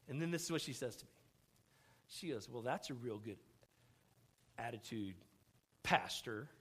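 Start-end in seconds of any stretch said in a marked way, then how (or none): 2.5-6.5 low, soft, pointed jab; quiet reproach. Retold or imitated. Wife shaming her pastor husband, quietly reproachful. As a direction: scold softly, with spaces before the last words.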